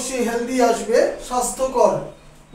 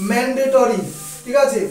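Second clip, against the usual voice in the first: ordinary talk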